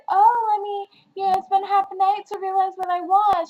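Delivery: high, loud mocking imitation of a dithering girl; mock Grace voice. Teen reviewer mocks Grace deciding too late, high mocking voice. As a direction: play her as she drags it out all night. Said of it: "she decided".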